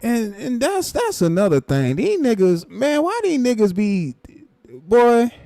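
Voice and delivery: can't believe these guys, voice climbing; exasperated. Real, not acted.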